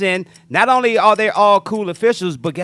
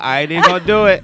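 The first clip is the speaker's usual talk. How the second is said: laughing; 6 dB louder, faster than usual. Real, not acted. Laughing refusal to joke.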